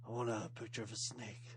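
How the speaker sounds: low, soft tough-guy voice; Snake impression. Tough prisoner asking tattoo artist for snake, low and soft. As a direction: drop the voice low and quiet like a hard man. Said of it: "Amazing snake imitation".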